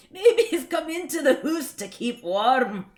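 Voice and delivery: put-on high, loud Scots accent. Reading the hedgehog comic on stream, loud Scots accent. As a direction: belt it out in broad comic Scots. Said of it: "tempted to read this in Scots".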